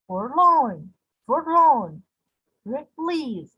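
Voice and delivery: "forlorn" with LORN swelling high; weak first syllable, strong LORN and LEASE in "forlorn, release"; stress on second syllable. Shown as a demonstration. Teacher listing iambic examples, second syllable stressed. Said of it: "unstressed, followed by a stressed syllable".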